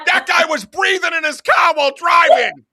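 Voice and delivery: high, loud, outraged complaint; mock road-rage rant. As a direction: rant like a furious driver at a harmless guy. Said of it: "She's like".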